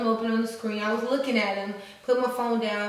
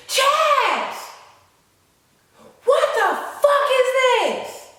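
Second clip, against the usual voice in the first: high, loud outraged shriek; mom cussing out; 14 semitones higher, 7 dB louder, much slower than usual. Retold or imitated. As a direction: yell it like an outraged mother. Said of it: "already getting cussed out".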